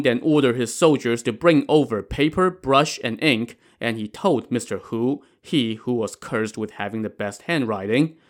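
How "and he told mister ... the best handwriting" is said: plain reading voice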